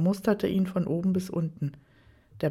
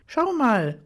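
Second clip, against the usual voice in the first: higher, louder sudden call; 6 semitones higher, 4 dB louder than usual. Acted. Daniel calls Aaron over to a market stall, sudden call. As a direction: call out to get his attention. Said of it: "rief Daniel plötzlich".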